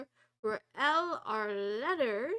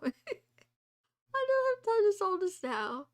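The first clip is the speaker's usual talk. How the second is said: laughing, higher and louder; a higher, louder repeat broken by laughter; 8 semitones higher, 8 dB louder than usual. Real, not acted. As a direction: laugh it out in mock despair.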